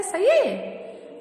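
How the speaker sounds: very slow, high-pitched "सही है?"; teacher quizzing pupils with a long rising question; drawn-out questioning. Shown as a demonstration. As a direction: stretch it out, inviting doubt. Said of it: "नहीं".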